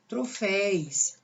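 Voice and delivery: "troféis" said slowly, ending in -éis; common mispronounced plural. Shown as a demonstration. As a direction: draw out the wrong plural "troféis". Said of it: "Muitas pessoas falam".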